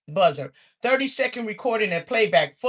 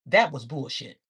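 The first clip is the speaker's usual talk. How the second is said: button voice; 8 semitones lower than usual. Retold or imitated. Button speaks third time.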